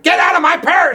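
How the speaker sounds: high-pitched, quoted yell; mock angry shout. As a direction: yell it like an indignant movie wife. Acting Linda's quarrel from the film.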